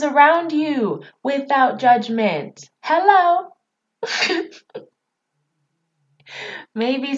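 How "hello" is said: mock hello. Mock calling out, then laughs.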